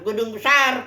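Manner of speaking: ordinary talk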